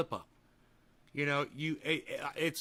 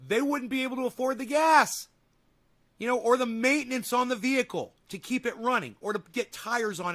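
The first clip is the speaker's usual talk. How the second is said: louder and higher; worked up; 7 semitones higher, 5 dB louder than usual. Real, not acted. Streamer defending friends who can't afford cars, worked up. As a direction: push it out, getting heated. Ranting about friends' money.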